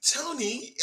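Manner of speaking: mock Cockney, light and high; Cockney accent. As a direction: tease him with a high little Cockney imitation. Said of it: "with this Cockney accent".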